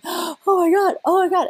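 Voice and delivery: high, breathless thrill like after a roller coaster; replayed giddy amazement; giddy laughing exclaim. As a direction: gush with excited relief. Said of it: "I'm laughing and I'm going".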